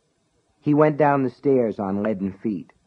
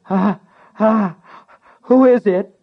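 high, loud panicked shriek; stammering terrified shriek; shrieking little voice; 11 semitones higher, 8 dB louder than usual. Acted. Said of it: "in a shrieking little voice".

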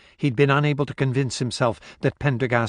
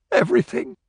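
sobbing; 8 semitones higher, faster than usual. A narrator's reading. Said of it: "she sobbed".

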